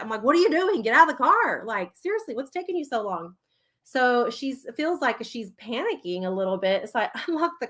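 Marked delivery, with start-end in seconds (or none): 0.2-1.6 retold parent's telling-off; irritated scolding. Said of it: "I'm not laughing at that". 1.7-3.3 retold parent's impatient muttered scolding; quietly fed up with a dawdler; super irritated. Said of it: "I'm exaggerating 'cause I'm, like, super irritated".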